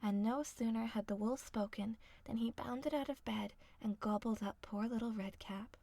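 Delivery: ordinary delivery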